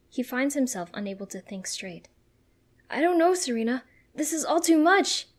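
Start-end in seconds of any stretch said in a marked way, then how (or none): 2.9-5.3 loud, raised, overwhelmed yell; yelling back. A narrator's reading. Ash overwhelmed by the blaze, yelling back to Serena. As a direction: yell it over the noise of a fire. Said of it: "he yells back".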